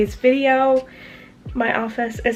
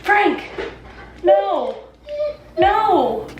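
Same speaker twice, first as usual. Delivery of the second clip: scolding the dog; 7 semitones higher, much slower than usual. Real, not acted. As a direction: stop the dog with a firm call.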